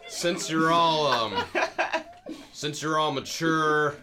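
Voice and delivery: slow, breaking into laughter; laughing through words. Acted. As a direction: try to talk while cracking up. Dad laughing through his line.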